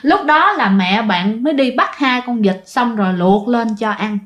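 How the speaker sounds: ordinary delivery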